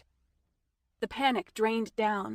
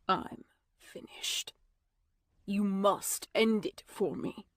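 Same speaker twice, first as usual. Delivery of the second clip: slow, spent vampire voice; hollow and slow, resigned to the end; a hint of a broken, fading man; weary dying man; slower than usual. Dying Merrick asks for death.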